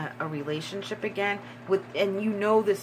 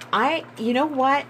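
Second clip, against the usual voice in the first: excited; 7 semitones higher, 6 dB louder than usual. Real, not acted. Breaks off, overwhelmed.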